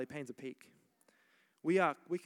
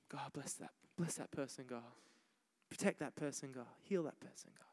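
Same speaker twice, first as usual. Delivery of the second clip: whisper-like little prayers under the breath; hushed pleading to God; quiet prayer; 7 dB quieter than usual. Shown as a demonstration.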